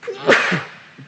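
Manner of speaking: another loud sneeze; a real hay fever sneeze; sneezing. Real, not acted. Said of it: "and again".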